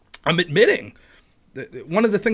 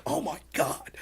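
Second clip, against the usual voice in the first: hushed, higher-pitched dismay; retold small-voiced alarm; mock horror; 5 semitones higher, 9 dB quieter, slower than usual. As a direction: gasp it softly as if mortified. Retelling his mortified realization.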